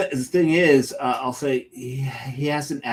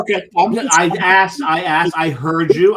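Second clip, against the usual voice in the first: exasperated; 5 semitones higher, 7 dB louder than usual. Real, not acted. Exasperated at being told slowly.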